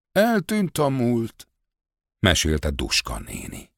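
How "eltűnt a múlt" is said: high, slow storytelling aunt; higher-pitched, drawn-out quote of an aunt; voiced village aunt. A narrator's reading. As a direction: lament it slowly in a raised woman's voice. Old woman Duska's quoted line.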